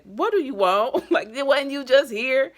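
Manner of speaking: high, loud incredulous receptionist with a laugh; imitating the receptionist's loud, laughing brush-off; mocking receptionist. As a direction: mimic a fed-up receptionist, slowed and scoffing. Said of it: "the receptionist said".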